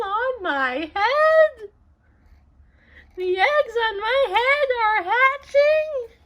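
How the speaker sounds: high, loud panicked wail. Acted. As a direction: wail in full storybook panic. Gerald panics as the eggs hatch.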